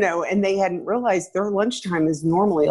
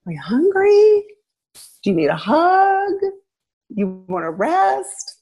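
high, gentle caring voice; tender check-in with a frustrated child; soothing questions to a child; 10 semitones higher than usual. Shown as a demonstration. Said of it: "Then you might be able to say".

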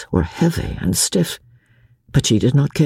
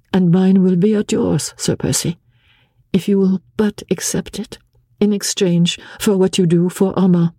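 impulsive; 6 semitones higher than usual. A narrator's reading. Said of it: "as impulsively".

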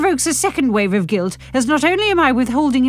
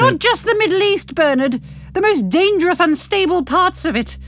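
alarmed protest; 9 semitones higher than usual. Acted.

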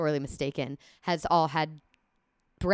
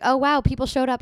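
mock surprised instructor; 7 semitones higher, 7 dB louder than usual. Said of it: "they're like".

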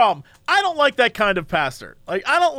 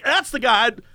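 usual speaking voice

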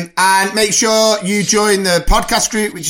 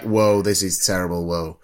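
low, soft, underwhelmed whoa; mock unimpressed; 10 semitones lower, 6 dB quieter than usual. Imitating two bored friends at the cinema, low and unimpressed. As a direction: drop it right down, deadpan and quiet. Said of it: "me and you in the cinema were like".

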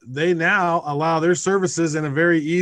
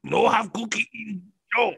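cookie monster voice; 6 semitones higher than usual. Pretend. Cookie Monster style speech.

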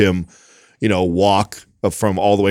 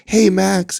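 much higher, bright teacher hello; teacher spotting the boy at the open house, sing-song hello; cheery teacher greeting; 11 semitones higher, much slower than usual. Retold or imitated. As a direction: greet a small child you recognize. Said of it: "She goes".

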